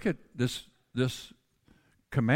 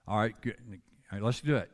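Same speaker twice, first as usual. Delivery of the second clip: normal talking voice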